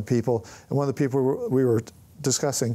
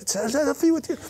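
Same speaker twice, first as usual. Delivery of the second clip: put-on high, hopeful kid asking for a selfie; eager young fan; 11 semitones higher, slower than usual. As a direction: pitch it up like an excited teen. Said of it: "He's like".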